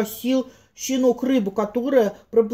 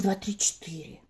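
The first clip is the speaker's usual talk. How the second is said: whispered count; 4 semitones lower, 10 dB quieter than usual. A narrator's reading. Digging count fades out.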